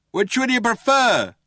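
high, loud, slow shout back; Ford shouting. A narrator's reading. Ford answering the cops' threat, shouting. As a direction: call it back loudly, drawing it out. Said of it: "shouted Ford".

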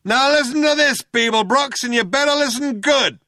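high, loud shouted order; shouting cop. A narrator's reading. Cop ordering Beeblebrox to listen good, shouting. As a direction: shout a warning across the room.

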